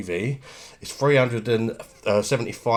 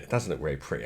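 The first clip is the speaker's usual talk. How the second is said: quiet aside repeating the remark; muttered repeat; 6 semitones lower, 5 dB quieter, faster than usual. Real, not acted. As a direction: lower and softer, under the breath. Repeats remark, second time muttered.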